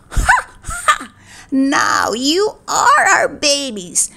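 slow, drawn-out wicked monkey gloat. A narrator's reading. Wicked monkeys tell the stolen babies they are theirs, gloating. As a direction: cackle high, then gloat slowly.

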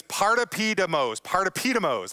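"partopidemos" said carefully, syllable by syllable; Greek word demo. Shown as a demonstration. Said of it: "it's how you say it like this".

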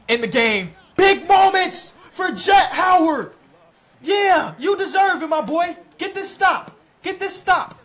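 high, loud cheering with drawn-out words; hyped shouting. Real, not acted. Player cheering his rookie into a big moment, excited shouting. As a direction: hype up the rookie at full volume.

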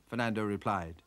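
usual speaking voice

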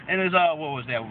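ordinary delivery

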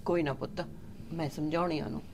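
soft, low calming of an angry son; mother quietly calming her son; soothing. Acted. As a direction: settle him down gently.

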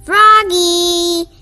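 loud stern call of the name. Acted. Librarian calls Froggy to stop roaring, loud stern call. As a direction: call him sharply across the room. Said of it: "called Miss Otterbottom".

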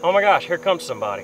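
sudden pitch jump, caught off guard; startled at an approaching boat; surprised. Real, not acted.

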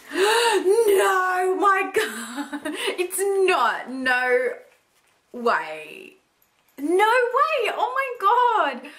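high, laughing no way; thrilled disbelief. Real, not acted. Finding the lipstick she wanted, high thrilled disbelief. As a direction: squeal it, can't believe it. Discovers a wished-for gift.

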